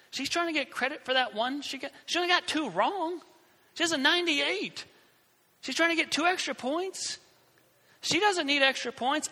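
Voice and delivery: high, indignant inner rant. Retold or imitated. To himself about Beverly, a high indignant rant. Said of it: "I'm like".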